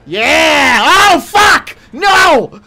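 sudden high, loud startled yells; startled scream. Real, not acted. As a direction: jolt out a real panicked shout. Jump scare while playing horror game.

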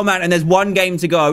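usual speaking voice

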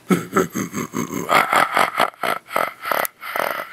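low, soft breathy chuckle; breathy laugh. Real, not acted. Vlogger laughs quietly after his whisper. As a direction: laugh under your breath.

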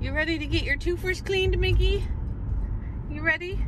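high, singsong doggy talk; pet talk. Real, not acted. Owner asking dog about getting teeth cleaned, high pet talk. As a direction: ask the dog in a high, eager lilt.

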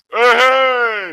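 high loud hammed-up 'hey hey'; mock crew shout. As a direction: call out like a whole room answering. Whole crew turns around.

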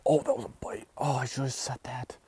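breathy hushed whisper of a missed bite. Real, not acted. Angler whispering to viewers after the missed bite, hushed. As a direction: whisper so the fish won't hear.

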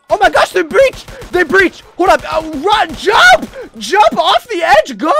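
high, full-voiced play-acted yelling; panicked shout. Player warns officers zombies breached the station, panicked shout. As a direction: scream orders to flee as they break in.

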